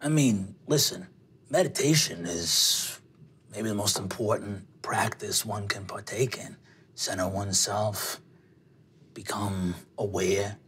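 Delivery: put-on low, soft older guy's voice; offhand sage in a low register; shrugging older man. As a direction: dispense wisdom low and quiet, with a shrug. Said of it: "he shrugs and goes".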